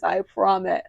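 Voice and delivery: ordinary talk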